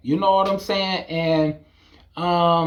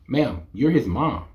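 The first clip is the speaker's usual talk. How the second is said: usual speaking voice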